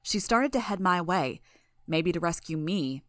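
plain reading voice

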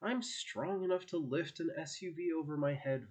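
plain reading voice

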